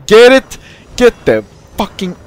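high, loud burst; urgent shout. Real, not acted. Player pushing for a move in the game, urgent shout. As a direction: yell it out suddenly.

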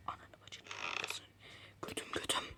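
breathy voiceless swearing under the breath; whispered cursing. Real, not acted. Gamer hiding from Granny, whispering curses. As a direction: hiss the curses so nobody hears.

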